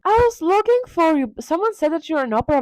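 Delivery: high-pitched, worried friend voice; lightly hammed-up concern; mock fretting Basil. Basil finding Dorian next day, high fretful voice.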